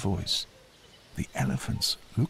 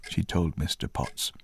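ordinary delivery, nothing marked

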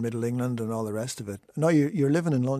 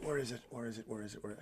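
quiet mutter while searching; muttering to himself; 13 dB quieter, faster than usual. Real, not acted. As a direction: mumble under your breath, off mic. Host searching for the record.

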